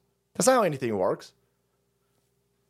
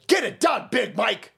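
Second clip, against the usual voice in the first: normal talking voice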